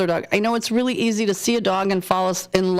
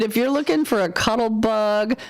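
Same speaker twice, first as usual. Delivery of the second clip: normal talking voice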